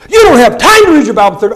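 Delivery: loud pulpit shout, fast; preacher's shout. Retold or imitated. Pastor calls out the young man from the pulpit: loud shout. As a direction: thunder it from the pulpit.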